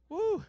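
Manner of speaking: high loud whoop; rally the crowd with a whoop; crowd hype whoop. Real, not acted. Hyping the crowd.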